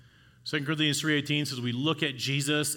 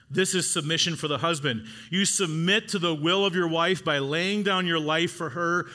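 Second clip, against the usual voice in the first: higher, louder preacher's drive; preaching at full force; 5 semitones higher, 4 dB louder than usual. Acted. Pastor urging husbands, preaching at full force. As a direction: press it home to the husbands.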